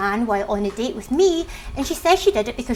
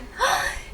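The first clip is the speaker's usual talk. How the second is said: quick voiceless gasp; shocked gasp; 4 dB quieter than usual. Real, not acted. Reader hearing the stepmum's dig, shocked gasp. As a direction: gasp at a cutting remark. Reaction to the shitty mum caption.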